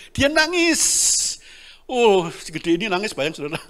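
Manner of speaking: high, loud exclamation of the reveal; excited exclaim. Real, not acted. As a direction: announce it with great excitement. Dramatic reveal in sermon. (seruan penuh semangat, keras dan tinggi)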